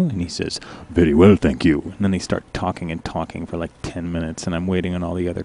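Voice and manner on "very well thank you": low, loud and smooth; deep suave reply with a faint accent; Richard's low voice. Retold or imitated. Coworker answering the girl in a low suave voice. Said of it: "his voice is really low".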